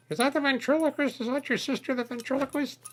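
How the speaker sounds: squeaky high puppet voice. Acted. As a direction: pitch it way up.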